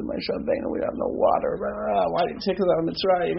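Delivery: high-pitched mock griping; obnoxious complaint. Rabbi imitating the people demanding water from Moshe, obnoxious. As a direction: whine it in a quick, high, hammed-up rush. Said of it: "in the most obnoxious way".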